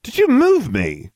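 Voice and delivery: high, loud, slow indignant squawk; squawked indignantly. A narrator's reading. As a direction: squawk it, outraged. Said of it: "I squawked indignantly".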